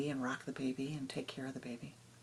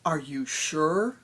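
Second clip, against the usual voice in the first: ordinary delivery, nothing marked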